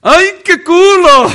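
very high, very loud, slow and drawn-out mock catcall; hammed-up street admirer hollering; imitating men whistling at a woman in the street. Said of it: "Y ella me dice".

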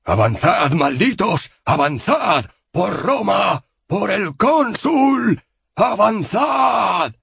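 high, roaring commander's shout stretched out; shouted battle orders. A narrator's reading. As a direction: bellow orders into the fog. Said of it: "Cayo Valerio gritó".

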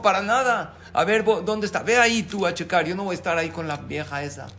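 high, loud flustered denial; indignant husband. Acted. Husband refuses to check the wardrobe, loud and offended. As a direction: brush it off, offended.

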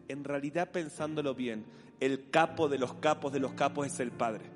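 usual speaking voice

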